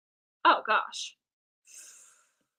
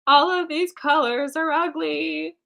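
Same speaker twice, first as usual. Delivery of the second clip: higher, slow complaint; drawn-out dismay; 7 semitones higher, 3 dB louder, slower than usual. Real, not acted. Artist grumbles at the ugly colors, drawn-out dismay. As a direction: drag out the complaint, pitched up.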